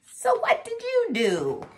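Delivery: high-pitched little mouse voice; challenging mouse. A narrator's reading. Mouse challenges the one taking credit, high voice. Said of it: "Mouse said".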